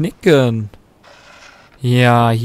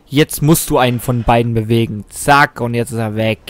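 usual speaking voice

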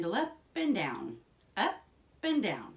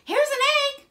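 very high, loud cry; excited shout; 13 dB louder than usual. A narrator's reading. Jack announces the egg to his mother, excited shout. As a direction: yell it in triumph, pitched way up. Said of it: "shouted Jack".

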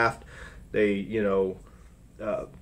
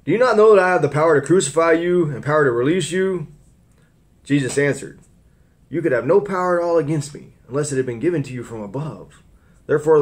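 scripture reading; 4 semitones higher than usual. Acted. Preacher reading Pilate's trial to the church, raised voice. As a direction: read the Gospel out to the congregation.

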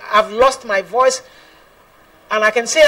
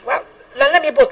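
normal talking voice

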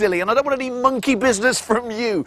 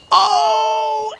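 startled cry; 11 semitones higher than usual. Real, not acted. Startled by Sooty's trick.

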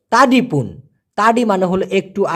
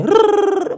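high rolled rrrr imitating rapid speech; mock fast gabble; 12 semitones higher, much slower than usual. Teacher mimicking someone who talks too fast. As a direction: rattle it off like unintelligible chatter. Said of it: "যারা কিনা খুব দ্রুত বলে".

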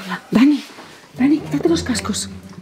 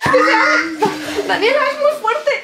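panicked laughing screams; 8 semitones higher than usual. Pretend. Mother panics at zombie, laughing.

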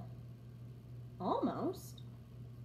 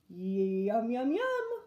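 whinnying yum; 5 dB louder, much slower than usual. A narrator's reading. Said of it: "whinnied Horse".

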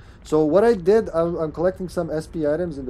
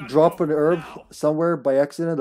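ordinary delivery

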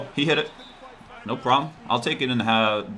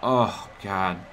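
ordinary talk, nothing marked

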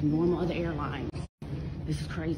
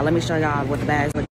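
ordinary talk

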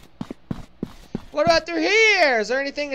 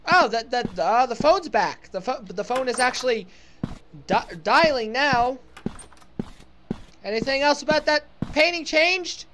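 usual speaking voice

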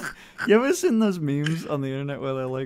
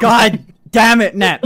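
loud, slow, higher exasperated groan; exasperated outburst; 8 semitones higher, 12 dB louder, slower than usual. Real, not acted. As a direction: throw it out loudly, caught off guard. Exasperated at friend's flirty joke.

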